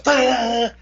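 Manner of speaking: high, held "da" like a movie sting; dramatic reveal sting. Retold or imitated. Storyteller imitating the camera's big reveal, dramatic sting. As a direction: imitate a shock-reveal music hit. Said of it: "and then the camera goes".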